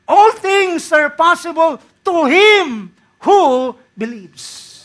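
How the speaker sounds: high and loud, falling away on believes; preacher proclaiming the verse full voice; loud slow proclamation. Acted. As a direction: declare it to the back row. Proclaims verse, then repeats quietly.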